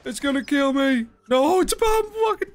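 panicked. Real, not acted. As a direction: scream it in real panic. Panicked, pig killing him in game.

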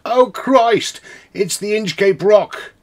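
high, loud cry of terror; a sailor shrieking as the ship hits; terrified cry. Acted. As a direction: scream it as the hull cracks. Ship strikes the rock.

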